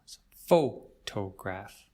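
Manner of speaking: It's photograph, PHO stressed, slow. Shown as a demonstration. Teacher modelling stress for IELTS students, slow and clear. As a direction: lean on the first syllable of photograph. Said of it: "we stress the first syllable".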